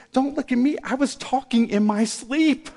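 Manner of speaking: lightly voiced husband pleading innocence; defensive husband. Husband to his upset wife in counselling, lightly voiced mock protest.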